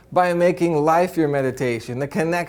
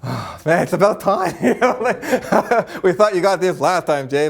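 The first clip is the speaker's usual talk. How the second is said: laughing spirit guides; 2 semitones higher than usual. Retold or imitated. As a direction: tease him fondly through a laugh. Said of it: "the guides going".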